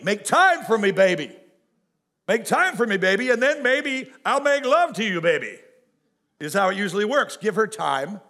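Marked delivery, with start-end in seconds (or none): 0.0-5.6 mock pleading wife. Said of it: "ladies, spell it T I M E".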